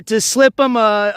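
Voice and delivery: normal talking voice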